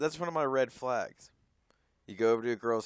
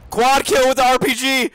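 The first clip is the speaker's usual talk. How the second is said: louder, higher triumphant yell; shouted brag; 10 semitones higher, 16 dB louder than usual. Real, not acted. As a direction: shout the win. Excited gaming brag.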